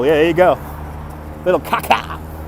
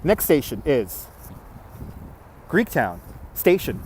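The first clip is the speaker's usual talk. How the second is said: train announcement; 6 semitones lower, 11 dB quieter than usual. Retold or imitated. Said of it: "You're in Detroit".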